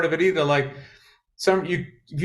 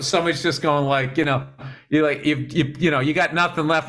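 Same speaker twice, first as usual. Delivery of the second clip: usual speaking voice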